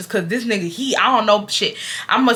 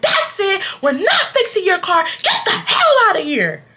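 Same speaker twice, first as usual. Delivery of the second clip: high, mock angry kick-out; angry mechanic; 10 semitones higher than usual. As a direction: throw her out of the shop, hammed up. Mechanic ordering her out, retold.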